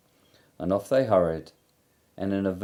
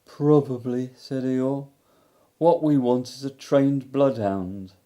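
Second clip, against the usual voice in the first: higher, mournful donkey voice; Eeyore's melancholy voice; 5 semitones higher, 5 dB louder than usual. A narrator's reading. Eeyore telling Christopher Robin of his lost house, melancholy. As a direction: sigh it out like a gloomy old donkey.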